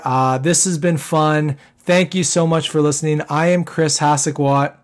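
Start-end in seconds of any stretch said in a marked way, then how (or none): none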